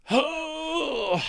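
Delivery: high wailing oh; dismayed oh. Real, not acted. Opponent plays removal again.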